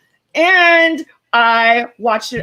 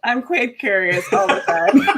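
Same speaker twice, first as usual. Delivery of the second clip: laughing; 3 semitones higher than usual. Real, not acted. Joking banter, laughing.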